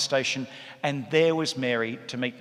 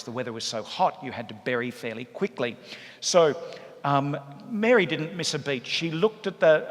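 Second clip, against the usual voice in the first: ordinary talk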